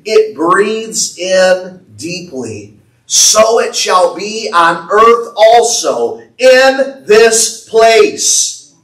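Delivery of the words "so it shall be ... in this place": rising, louder preacher's proclamation; fervent pulpit declaration; preaching. Real, not acted. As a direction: build the prophecy up to a peak. Preaching rises to full force.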